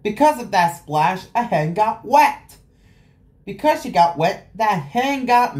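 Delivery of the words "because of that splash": ordinary delivery, nothing marked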